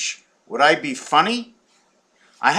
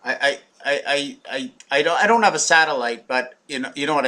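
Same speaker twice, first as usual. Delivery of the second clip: ordinary talk, nothing marked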